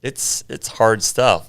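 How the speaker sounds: ordinary talk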